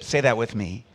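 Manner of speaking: ordinary delivery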